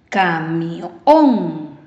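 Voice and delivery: "Camión" drawn out, swelling on a long final "ón"; stress on last syllable. Shown as a demonstration. Teacher asking pupils to hear the force, long stressed "ón" in "Camión". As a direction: stretch "Camión" and push the "ón". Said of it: "¿Escuchas la fuerza de voz?".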